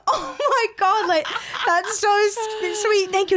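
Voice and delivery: high, drawn-out giggly gush; laughing. Retold or imitated. Woman retelling her reply to a flattering stranger, laughing.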